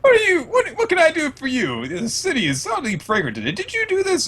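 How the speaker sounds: fake-sweet giant queen fawning; high cooing questions; sweet Big Mom. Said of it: "but she says".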